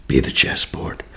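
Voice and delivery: breathy hushed whisper. Shown as a demonstration. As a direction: whisper it like a secret truth. Breathy closing line.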